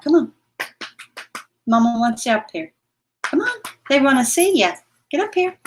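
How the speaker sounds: high sing-song coaxing a pet; high pet-talk coaxing. Real, not acted. Owner coaxing her pet up to say hi, high and sweet.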